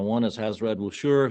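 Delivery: usual speaking voice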